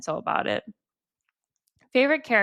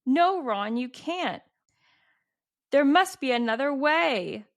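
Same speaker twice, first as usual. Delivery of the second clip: high melodramatic read-out plea; dramatic plea; 5 semitones higher than usual. Retold or imitated. Reading Hermione begging Ron to reconsider, dramatic. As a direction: imitate a dramatic protest, pitched up. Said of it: "they're so dramatic".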